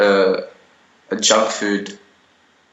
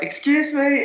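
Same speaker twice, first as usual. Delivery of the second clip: mock girl calling. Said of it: "and they're like".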